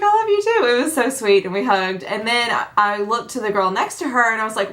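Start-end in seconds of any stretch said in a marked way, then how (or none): 0.0-0.7 much higher, imitating her squeal back; excited reply. YouTuber returning the fan's love, mock excited squeal. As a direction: echo the fan's delight in a high gush.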